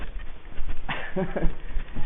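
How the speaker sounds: breathy mock laugh, high and loud; a woman's mocking laugh, acted out; imitated mocking laugh. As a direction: imitate her laughing at you as you pass. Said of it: "she went like this".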